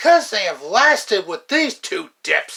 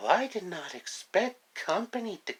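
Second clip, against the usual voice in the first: a light sultry screen-siren purr, softer; Mae West; 2 semitones lower, 8 dB quieter than usual. Acted. Actress surprised by detectives, in a sultry siren voice. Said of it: "who sounds a lot like Mae West".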